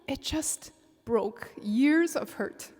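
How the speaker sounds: ordinary talk, nothing marked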